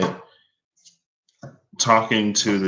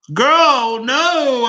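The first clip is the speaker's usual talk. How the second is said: very high, loud playful dismay; mock outrage; 8 dB louder than usual. Vlogger reacting to the booking options, mock outrage. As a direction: squeal your refusal in mock horror.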